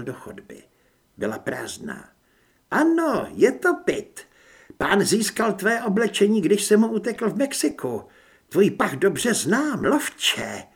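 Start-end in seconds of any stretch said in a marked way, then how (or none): 2.7-10.6 high, raised villainess voice; high-pitched enemy woman; hostile werewolf woman. A narrator's reading. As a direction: taunt the hunter from across the room.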